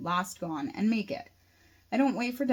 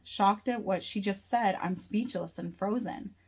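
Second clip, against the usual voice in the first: plain reading voice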